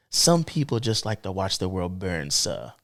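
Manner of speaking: low, soft British butler; lightly attempted British accent, dropping on the last word; British accent impression of Alfred. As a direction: put on your best British accent, measured and low. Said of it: "do my best British accent".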